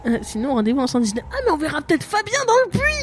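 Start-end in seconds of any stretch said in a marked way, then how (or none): 1.3-3.0 excited. Real, not acted. Joking excitedly about a friend.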